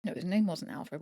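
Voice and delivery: usual speaking voice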